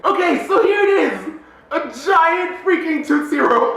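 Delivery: high, loud triumphant reveal; excited shout. Real, not acted. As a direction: shout it like a big unveiling. Reveals the giant candy.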